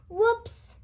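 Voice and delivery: usual speaking voice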